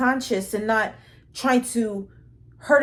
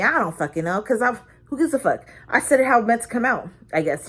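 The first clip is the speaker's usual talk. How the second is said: normal talking voice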